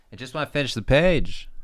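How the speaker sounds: high, loud frustrated complaint; exasperated outburst. Real, not acted. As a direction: burst out, pitch leaping up on "page". Frustrated outburst at slow drawing.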